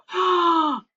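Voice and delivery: sharp, high gasp; sudden gasp of realization; startled gasp. Real, not acted. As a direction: gasp at your own mistake. Realizes she went the wrong way.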